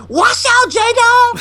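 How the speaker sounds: very high, urgent holler; imitated warning shout. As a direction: shriek a warning to a coworker. Coworker's warning acted out.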